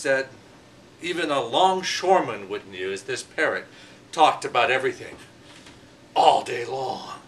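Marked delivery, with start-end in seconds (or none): 6.1-7.1 slow, stretched emphasis on every word; drawn out for effect. A narrator's reading. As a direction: drag the words out to show it never stops. Storyteller stresses endless noise.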